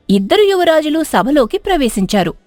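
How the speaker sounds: ordinary delivery, nothing marked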